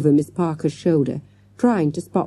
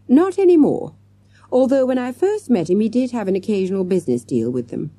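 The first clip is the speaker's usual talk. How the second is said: stern; 6 semitones higher than usual. A narrator's reading. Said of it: "she added sternly".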